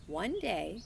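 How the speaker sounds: ordinary delivery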